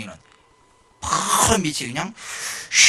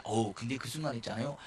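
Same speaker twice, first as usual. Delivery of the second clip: usual speaking voice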